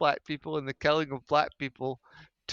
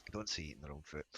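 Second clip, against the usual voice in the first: much lower, much softer self-correction; muttered aside; 12 semitones lower, 16 dB quieter, faster than usual. Real, not acted. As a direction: mutter it to yourself. Correcting himself under breath.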